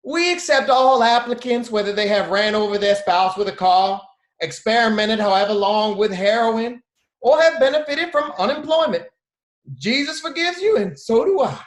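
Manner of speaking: high, loud, preachy welcome; born-again hiring manager. Retold or imitated. Aunt as hiring manager preaching to applicants, high and loud. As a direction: sell forgiveness like a zealous aunt. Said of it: "if she was the hiring manager".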